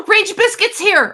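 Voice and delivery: high, loud burst of excited surprise; gasp, then call it out, thrilled; loud, high-pitched delighted announcement; excited greeting. Real, not acted. Spots a viewer arriving in chat.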